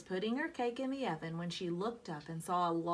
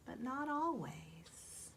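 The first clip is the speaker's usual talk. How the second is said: slow hushed whisper; 3 semitones higher, 6 dB quieter, slower than usual. A narrator's reading. Narrator hinting at sadness, slow whisper. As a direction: let it hang, slow and secret.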